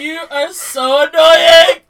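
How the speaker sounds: loud slow crying complaint; crying tease. Real, not acted. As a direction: scold her while crying. Teasing through tears.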